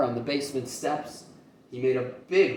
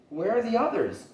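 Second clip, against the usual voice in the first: eager question; 9 semitones higher than usual. Acted. Eager child asks.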